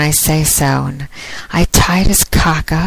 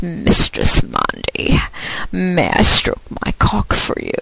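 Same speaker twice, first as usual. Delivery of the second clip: breathy whispered British accent, mocking her caller; hushed British voice of a submissive caller; imitated British accent. Podcaster imitates her British caller in a whispered British accent. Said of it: "with a British accent".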